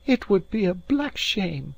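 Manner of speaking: put-on high-pitched young woman's exclamation; indignant maid's cry. A narrator's reading. Maid hears the lad may lose his head, indignant. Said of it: "she cried, flushing high".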